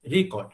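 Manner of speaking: Record said as RE-cord, first syllable stressed; teacher marks the stressed first syllable, record; noun stress. Shown as a demonstration. Stress first syllable of record. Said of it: "इससे ये पता चलता है कि ये stress हो रहा है".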